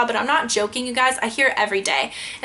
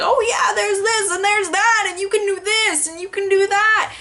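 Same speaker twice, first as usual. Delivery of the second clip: mocking the sister; 8 semitones higher than usual. Mocking her sister bragging about New York, high and gushing. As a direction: ham up a bragging little sister. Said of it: "my sister will be like".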